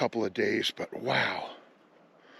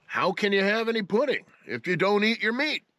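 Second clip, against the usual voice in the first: stern teacher shout; 8 semitones higher than usual. Retold or imitated. Quotes famous Pink Floyd teacher line.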